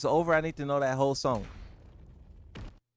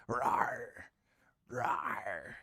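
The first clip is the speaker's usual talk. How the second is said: low growl; 8 semitones lower, 6 dB quieter than usual. Shown as a demonstration. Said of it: "They should have growling noise".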